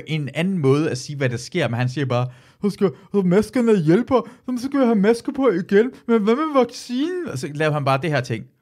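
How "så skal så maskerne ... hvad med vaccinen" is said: silly mocking voice. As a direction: ham it up like a clueless comic mocking masks. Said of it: "siger han ... med en mærkelig stemme".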